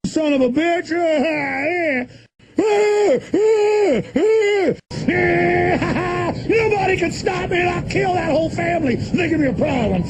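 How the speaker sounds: high, screeching maniac voice; a cackling madman's high shriek. Acted. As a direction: screech like an unhinged villain. High shrieking villain rant.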